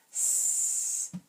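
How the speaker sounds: "S-ad" stretched sound by sound; segmented slowly. Shown as a demonstration. Teacher segments "sad" with students on sound boxes. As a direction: say "S-ad" in slow separate sounds. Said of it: "say it together while the students".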